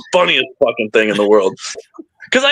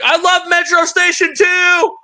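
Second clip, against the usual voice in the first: yelling. Retold or imitated. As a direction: yell it across the street at goths. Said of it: "Oh, I always yell at them".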